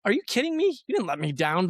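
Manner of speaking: mock reproach, leaping very high at first; imitated scolding disbelief. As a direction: act out an incredulous parent's high-pitched rebuke. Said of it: "That's how I made her feel".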